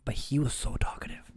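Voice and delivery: breathy whisper of disbelief; whispered aside. Real, not acted. Telling a friend about the chatty driver, whispered. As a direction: hush it like a secret.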